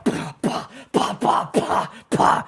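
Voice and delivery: breathy mimicked machine-gun fire; mock gunfire. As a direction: spit out gunshot noises like a war movie. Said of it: "It's like the scene from".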